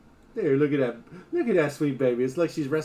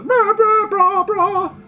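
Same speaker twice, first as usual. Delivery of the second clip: loud, very high blah-blah noise; high, loud silly blah noises; mock blabbering yell; 10 dB louder, much slower than usual. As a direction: drown your own explanation in loud babble.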